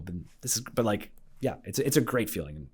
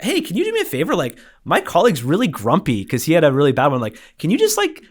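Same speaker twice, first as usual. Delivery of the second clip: high, loud, eager ask, faster at the end; excited request; 8 semitones higher, 9 dB louder than usual. Retold or imitated. Asking the patient to cheer up his colleague, high and excited. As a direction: rush the favour out with glee. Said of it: "and I'm like".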